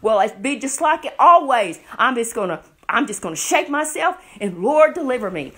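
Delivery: cocky Samson prayer. Acted. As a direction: pray loudly as if it's routine. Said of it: "he prayed and he said".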